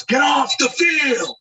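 slow, high drawn-out shout; groundskeeper yelling. Retold or imitated. Groundskeeper ordering players off the field, shouting. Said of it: "he goes".

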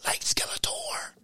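cartoon villain whisper, pitched high; evil voice. Shown as a demonstration. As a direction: show off your sinister villain whisper. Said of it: "This is my evil voice".

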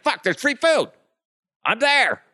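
high, loud yelp over free food; greedy joy; frantic excitement. Retold or imitated. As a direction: jump at it like he's starving. Greedy coworker spotting food.

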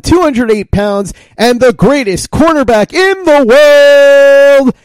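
booming arena announcer intro, drawn out; ringmaster. Shown as a demonstration. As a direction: hype up the crowd like a ringmaster. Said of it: "should have done it a little bit more like this".

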